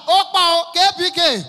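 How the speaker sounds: high, very loud hollering; imitated vendor yelling at full force; hawker's shout. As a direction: bellow the call at full volume. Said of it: "Na she shout".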